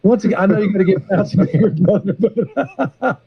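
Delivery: real laughter breaking up the words; laughing while talking. Real, not acted. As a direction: try to carry on through the giggles. Laughing at his own hugging joke.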